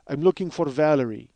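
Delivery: Russian repo man at the door; matter-of-fact Russian-accented voice. Acted. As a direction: do the Russian guy asking. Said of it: "I love doing this Russian guy's voice".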